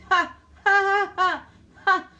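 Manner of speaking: loud, high scornful cackle of a laugh; mocking laugh. Real, not acted. To the chat about her so-called Conservative government, a loud mocking laugh.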